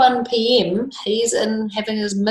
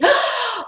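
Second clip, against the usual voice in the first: a sharp, high gasp of mock shock; a high, playful storyteller's gasp for the children; dramatic gasp. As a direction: gasp as if you've just spotted something. Storyteller gasps at the picture.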